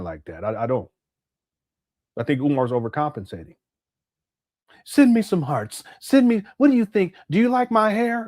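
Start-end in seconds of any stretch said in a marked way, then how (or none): 4.8-8.2 a hammed-up, fishing-for-compliments voice; imitating a man begging for hearts online; mocking Umar. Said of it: "you sound like a female".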